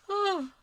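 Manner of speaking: a big real yawn breaking out; sudden loud tired yawn. Real, not acted. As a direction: let a yawn escape on the word. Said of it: "sorry, I'm yawning".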